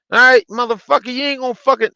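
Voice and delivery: high, loud outburst; retold angry tirade. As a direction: re-enact going off on someone. Retelling how they went off.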